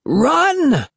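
high-pitched panicked cry; hoarse, high cry of alarm; shrill, frightened. A narrator's reading. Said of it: "his hoarse voice shrill and frightened".

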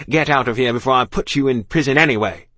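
loud, annoyed threat; officer shouting. A narrator's reading. Annoyed officer threatening child with prison, shouted. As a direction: send the child packing at volume. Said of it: "he shouted".

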